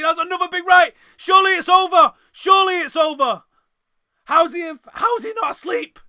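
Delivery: high, loud, disbelieving yell; excited shout. Real, not acted. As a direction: scream it like ringside chaos. Fighter dropped by right hand.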